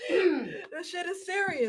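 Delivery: high, slow, half-crying; half-joking, mock tearful whine about the towels. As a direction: whine it out like you're tearing up. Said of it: "I'm just like".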